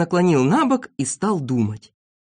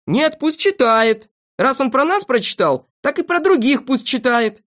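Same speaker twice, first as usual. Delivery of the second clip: high-pitched insistent demand; shouting; 8 semitones higher, 4 dB louder than usual. A narrator's reading. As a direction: call out to let him go on. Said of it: "Торопышка и Авоська кричали". (настойчивые выкрики)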